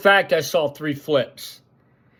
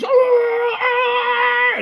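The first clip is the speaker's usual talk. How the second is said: a much higher, strained cry; mock scream; 4 dB louder than usual. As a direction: imitate the guy yelling in panic. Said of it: "this is what happens".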